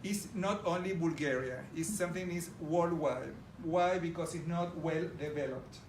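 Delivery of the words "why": ordinary talk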